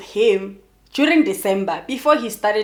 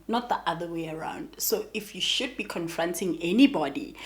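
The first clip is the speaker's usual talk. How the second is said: whisper; 4 semitones lower, 6 dB quieter than usual. Retold or imitated. Friend's retold harsh reply.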